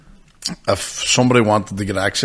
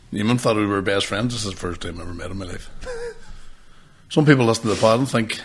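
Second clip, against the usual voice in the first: ordinary talk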